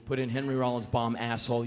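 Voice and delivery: usual speaking voice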